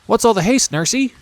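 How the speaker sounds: lightly voiced little girl, high; child asking. A narrator's reading. Princess asks her running nurse why the haste, light child voice. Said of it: "asked Irene".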